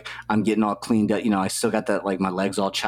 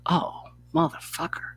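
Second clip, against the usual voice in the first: breathless whispered curse, pitched up; 8 semitones higher, 4 dB quieter than usual. Retold or imitated. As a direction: hiss it out as the realization hits. Sudden realization during meditation retold.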